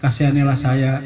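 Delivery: low, subdued plea for mercy; understated pitiful begging, voice dropped low; mock tearful pleading. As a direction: lightly imitate someone begging to be let go. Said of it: "nangis-nangis orang ini, minta dilepaskan".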